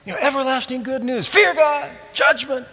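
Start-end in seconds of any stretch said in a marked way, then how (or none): 1.3-2.7 hammed-up, higher fearful cry; mock scary. Preacher mocking the fearful message to congregation, mock shout. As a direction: spook the crowd in jest.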